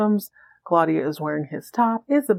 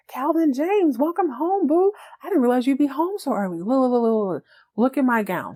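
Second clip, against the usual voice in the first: gushing mock-giddy girlfriend, higher pitched; 6 semitones higher than usual. Woman welcoming her boyfriend home, giddy. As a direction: play her all giddy, lilting up high. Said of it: "she's all giddy".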